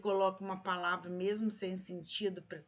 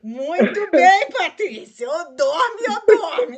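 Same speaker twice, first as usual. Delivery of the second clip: fala entre risadas, bem mais alto; rindo; 7 semitones higher, 19 dB louder, slower than usual. Real, not acted. Professora ri da canção. (rindo alto da canção da Patrícia)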